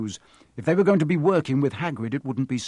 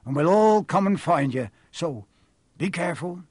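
higher, rustic giant; instructing groundskeeper; 5 semitones higher, 4 dB louder than usual. Acted. Groundskeeper splitting pupils into two parties, brisk. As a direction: give orders heartily in a country accent.

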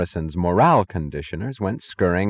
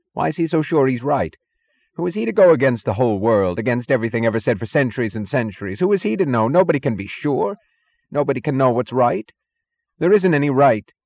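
high, loud, mounting agitation; frantic protest; 6 semitones higher, 4 dB louder than usual. A narrator's reading. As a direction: argue desperately against what was heard. Taggart's frantic protest in the quarrel.

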